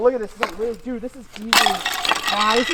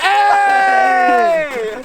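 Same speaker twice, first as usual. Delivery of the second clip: loud high call across the ice; shout; 9 semitones higher, 6 dB louder than usual. Real, not acted. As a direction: yell to get attention.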